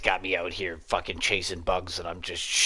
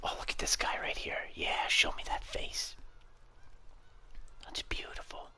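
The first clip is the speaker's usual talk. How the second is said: softer whisper; 7 dB quieter than usual. Real, not acted. Hunter stalking game, whispering. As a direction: hush so the animal won't hear.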